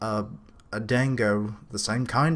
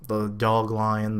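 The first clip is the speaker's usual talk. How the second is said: normal talking voice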